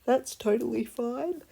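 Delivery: talking through a yawn. Real, not acted. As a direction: say it mid-yawn, stretched. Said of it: "Sorry, I'm yawning".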